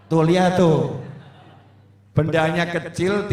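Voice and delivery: usual speaking voice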